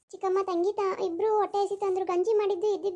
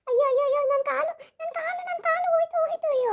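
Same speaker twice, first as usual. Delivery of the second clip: high, loud wails of pain; screaming in pain; 8 semitones higher, 3 dB louder than usual. Acted. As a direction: cry out as if the leg is breaking. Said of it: "ಯಾಕೆ ಅಷ್ಟೊಂದ್ ಚೀರ್ತಿದೀಯಾ". (ಕಾಲು ನೋವಿನಿಂದ ಜೋರಾಗಿ ಚೀರುವುದು)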